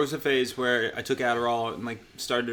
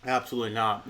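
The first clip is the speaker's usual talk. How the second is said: ordinary delivery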